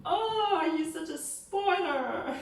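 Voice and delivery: Oh leaping high, playful scolding; mock sulky scolding. Shown as a demonstration. Missus Bennet scolding her husband, playful sulk. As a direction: tease him for spoiling the fun. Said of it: "she goes like".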